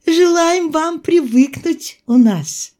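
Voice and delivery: high, slow female figure's voice; midwife's toast. A narrator's reading. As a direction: wish the newcomer well in a high voice.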